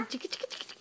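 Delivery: voiceless chiki, chiki, chiki; whispered tickle. Real, not acted. Tickling sound to baby.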